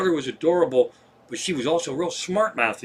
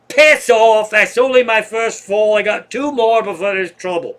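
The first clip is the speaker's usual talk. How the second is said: put-on higher, much louder indignant old lady; feisty drunk lady; 7 semitones higher, 8 dB louder than usual. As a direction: bark it off like a tipsy Irish woman who's fine. Drunk fallen lady rebuffs helper.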